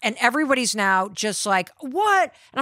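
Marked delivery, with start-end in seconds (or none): 1.8-2.3 jolted by the sudden U-turn; shocked what. Retold or imitated.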